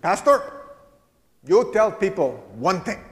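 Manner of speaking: put-on high, emphatic German-accented voice; hint of a spunky German accent, instructing her pastor; German-accented old parishioner. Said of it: "She had a very thick German accent".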